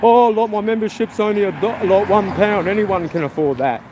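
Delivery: a lightly voiced braggart; mocking the streamer. Mimicking the channel owner bragging about cheap membership, smug. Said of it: "he was going".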